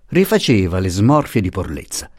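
ordinary delivery, nothing marked

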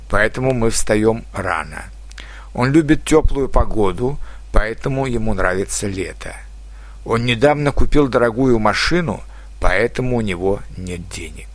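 normal talking voice

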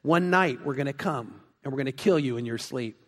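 low, soft, menacing prisoner voice; a prisoner whispering a threat through the cell slot. Retold or imitated. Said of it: "And he says".

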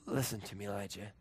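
low, soft appeal; gentle urging. Acted. God speaking to Elijah, low and gentle. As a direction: softly ask for his attention.

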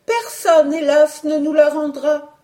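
high, words beaten out one by one; scanding. A narrator's reading. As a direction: stress each word, grieving. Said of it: "scanda Juliette".

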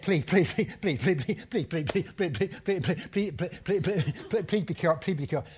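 rapid pleading chant of please; lightly voiced nervous woman begging; nervous passenger. Woman in a taxi begging the driver, rapid nervous pleading. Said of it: "And she said".

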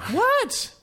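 sky-high indignant what; shrill disbelieving squeak; baffled what. Real, not acted. Baffled at racism remark.

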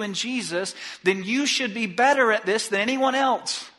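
high, loud sermon climb building to a peak; impassioned preaching. Acted. Rising fervent exhortation.

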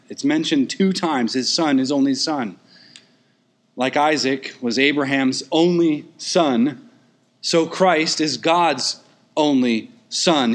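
ordinary delivery

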